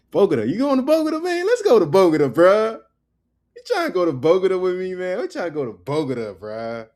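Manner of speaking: loud, high-pitched hyped-up bro, easing toward the end; mock gringo bro. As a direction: clown as a bro butchering the city name. Mock clueless tourist mispronouncing Bogota.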